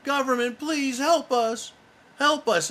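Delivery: mock pleading scream. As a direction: ham up a helpless citizen begging the government. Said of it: "trying to get us all to scream".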